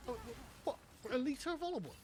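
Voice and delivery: incredulous rising outrage, pitch climbing; can't believe the price of oil; wife balking at the olive oil price, incredulous; disbelief. Retold or imitated. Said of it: "She's like".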